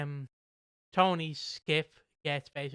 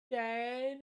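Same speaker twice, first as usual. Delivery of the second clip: mock scared whine, pitched up; whiny Lofty plea; 5 semitones higher, much slower than usual. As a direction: whimper for Dan's help like a scared child. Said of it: "Lofty just turns to the side and goes".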